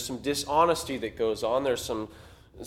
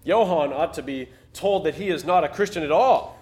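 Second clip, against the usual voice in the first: put-on louder, self-righteous young pastor; a louder, self-righteous read of Fridfeldt's thought; indignant thought; 3 semitones higher, 7 dB louder than usual. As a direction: push it out like a proud young preacher who'd love to blast him. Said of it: "thought Fridfeldt".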